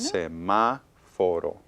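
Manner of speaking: "semáforo" slowed right down in separate chunks; divided into syllables. Shown as a demonstration. Teacher asking whether it needs an accent, syllable by syllable. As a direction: spell out "semáforo" beat by beat, slowly. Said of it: "divídanlo en".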